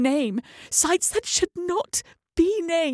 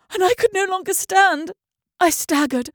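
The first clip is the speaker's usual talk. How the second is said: ordinary delivery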